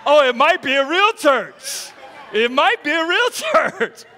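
high, loud, laughing revelation; mock amazed shout. Preacher acting his discovery of a real church, mock amazed shout. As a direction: holler it as a hammed-up discovery.

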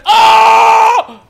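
very loud, high, held yell; startled scream. Real, not acted. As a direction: scream out in alarm.

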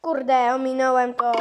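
normal talking voice

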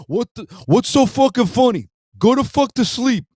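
high, loud mock-furious East Coast mobster shout; wannabe Tony Soprano. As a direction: imitate an angry Rhode Island tough guy yelling. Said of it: "as good as I get with the Sopranos accent".

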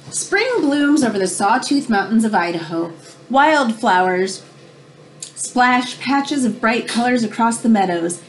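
ordinary delivery, nothing marked

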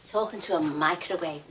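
dropped low, scornful repeat; mocking echo. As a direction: say it back with disbelief. Mocking echo of her own line.

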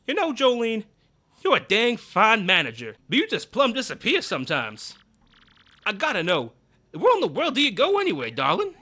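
hammed-up boss sweet-talking; Grubba's voice. Boss prying into his manager's whereabouts.

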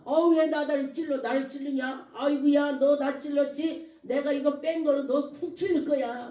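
mildly hammed-up angry accusation; fierce. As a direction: imitate someone stung and hitting back. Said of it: "내 말이 뭐 원성이 싸나워져".

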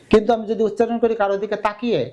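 ordinary talk

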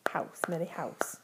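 softly whispered house to Millie. Real, not acted. Whispered correction.

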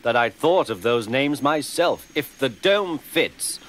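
usual speaking voice

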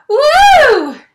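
very high, loud woo; joyful whoop. A narrator's reading. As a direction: whoop with triumph. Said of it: "He cried out".

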